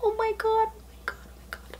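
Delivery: tiny high voice fading to whisper; overwhelmed hush. Real, not acted. Collector overwhelmed by the figurine, fading awed whisper. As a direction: start small and high, fade to breath.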